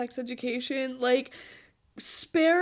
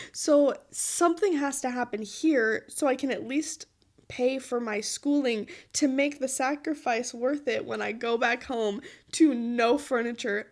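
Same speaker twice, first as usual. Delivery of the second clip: ordinary talk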